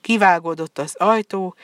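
ordinary delivery

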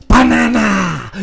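slow, high cartoon Minion. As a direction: stretch it out like a Minion. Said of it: "let me see if I can do my best Minion voice".

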